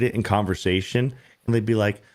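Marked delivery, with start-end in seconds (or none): none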